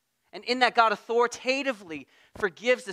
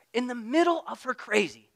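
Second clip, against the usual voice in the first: ordinary delivery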